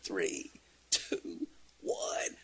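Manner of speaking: hushed count before pouncing; whispered countdown. Acted. Boys hiding behind the island counting down to ambush, whispered. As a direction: whisper each number, leaving space between.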